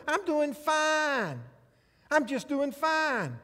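high, slow, sing-song I'm fine; mock cheerful fine. Preacher mocking the stock answer, sing-song fake cheer.

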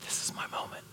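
hushed intense whisper; whispered hope. Retold or imitated. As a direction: breathe it as a secret. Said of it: "Bartimaeus said".

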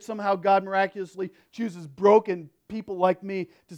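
loud, high preacher voice building fervor; full-force Sunday sermon; preaching. Acted. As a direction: preach it out to the whole room. Pastor preaching to congregation.

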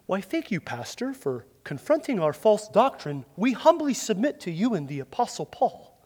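a hint of fake-polite false teachers; mock humble reply. False teachers pretending to humbly thank Timothy, softly.

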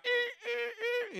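high whimpering "eh, eh" sobs, hammed up; mock crying. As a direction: imitate someone whining alone at home. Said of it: "at home, they're like".

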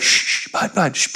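whisper-shush to quiet him down; soft breathy shh to a child. Retold or imitated.

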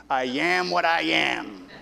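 slow, drawn-out cartoon sailor voice; Popeye. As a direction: imitate the cartoon sailor, stretching the words. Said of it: "like the great philosopher Popeye taught".

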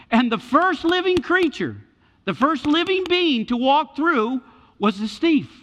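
high, loud, emphatic proclamation; full-force preaching. Real, not acted.

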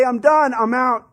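giving up. Acted. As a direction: throw in the towel. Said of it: "And we say".

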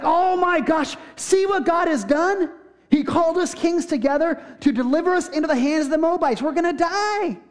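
high-pitched mock panic; freaking out. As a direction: cry out in hammed-up dread, pitched well above normal. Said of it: "he freaks out a little bit".

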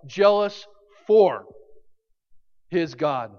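"for" slow and weighted against "of"; stress on for. Shown as a demonstration. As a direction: lean on "for", drag "jealous for". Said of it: "Not jealous of".

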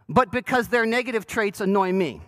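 normal talking voice

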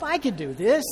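first push of a building chant; rising self-encouragement. Retold or imitated.

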